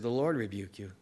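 low, soft and restrained; calm quiet rebuke. Retold or imitated. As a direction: say it gently, leaving it to God. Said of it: "he would say it in that sort of way".

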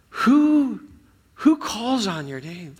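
high, pleading voice reading the lament. Acted. Pastor reading the verse to the congregation, high and pleading.

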